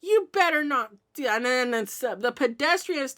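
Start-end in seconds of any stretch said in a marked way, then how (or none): 0.0-1.3 high-pitched, loud mock scolding; retold scold, much higher and louder. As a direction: lecture a reckless cat, voice way up. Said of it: "say something like".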